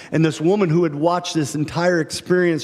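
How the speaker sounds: usual speaking voice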